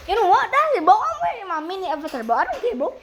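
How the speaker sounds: high, loud, fast defiant rant; fed-up outburst. Real, not acted. Gamer giving up on the match, high defiant rant. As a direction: throw your hands up and shout it out. Gives up, puts on avatar.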